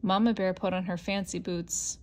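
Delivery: plain reading voice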